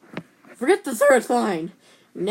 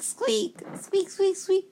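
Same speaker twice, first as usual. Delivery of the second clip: very high squeaks; 10 semitones higher, slower than usual. Pretend. Squeaking like a small animal.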